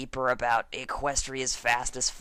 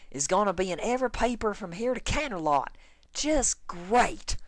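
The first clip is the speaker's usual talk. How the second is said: higher and fast, fuming; angry tirade; 6 semitones higher than usual. Acted. Farmer stomping around at Dash, fast fuming rant. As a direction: rant like a child in a tantrum. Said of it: "she gestured angrily".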